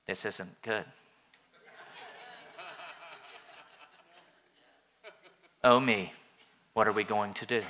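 low, soft, feeble fretting; mock timid Jesus. Wrong version of Jesus at the temple, fretting low and soft. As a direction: fret feebly, barely there. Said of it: "No, he, he doesn't do that".

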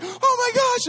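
high-pitched, hammed-up panic squeal; little girl scream. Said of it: "I'm screaming like a little girl".

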